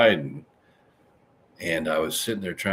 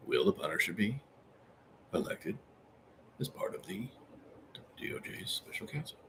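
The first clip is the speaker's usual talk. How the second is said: hushed question; 13 dB quieter than usual. Pretend. Asks the 8 ball again quietly.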